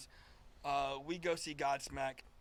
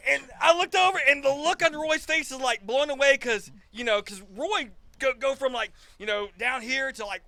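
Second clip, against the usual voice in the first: excited retelling; 8 semitones higher, 12 dB louder than usual. Real, not acted. Describing to the hosts how Roy looked after Sully sang, loud and excited. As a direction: tell it like you're still blown away. Retelling Roy's stunned face.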